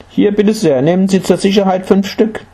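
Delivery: high-pitched, helpful woman's voice; obliging housewife. A narrator's reading. Housewife handing seeds to the visitor, obliging. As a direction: offer it generously, pitched well up.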